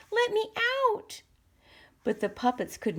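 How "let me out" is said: high, panicked cry for help; calling for help. A narrator's reading. Trapped puppet calls out from the cage, high and panicked. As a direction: squeal it out from the cage. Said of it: "called Pinocchio from inside the cage".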